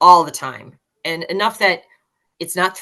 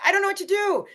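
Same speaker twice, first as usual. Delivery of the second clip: very high-pitched mock despair; hammed-up plaintive cry, pitched way up; helpless student wail; faster than usual. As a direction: act out a lost, overwhelmed student. Retold exasperated college player's outburst.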